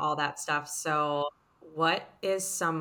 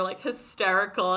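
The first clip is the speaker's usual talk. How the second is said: ordinary delivery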